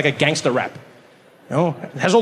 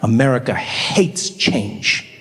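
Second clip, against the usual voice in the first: slow; 6 semitones lower, much slower than usual. Acted. Slow pronouncement.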